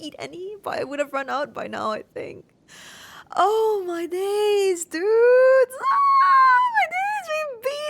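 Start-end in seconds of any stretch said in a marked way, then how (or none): 3.4-7.9 very high, loud, crying scream building then fading; ecstatic scream. Real, not acted. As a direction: scream with joy, almost sobbing. Screaming after boss kill.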